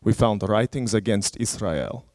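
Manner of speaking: badly faked Israeli accent that comes out Russian; Russian-sounding accent. Security guard confronts traveler over his bag, imitated Russian-sounding accent. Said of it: "my accent is gonna sound Russian".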